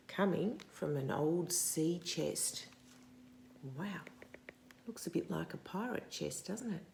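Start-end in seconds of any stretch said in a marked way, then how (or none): none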